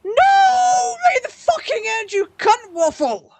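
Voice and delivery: screaming, very high and loud in real rage; furious shout. Real, not acted. Gamer robbed right at the finish line, furious screaming.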